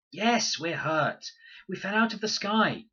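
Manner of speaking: put-on hurt girl telling of the crash; injured girl. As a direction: state it bluntly, shaken. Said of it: "said one bush".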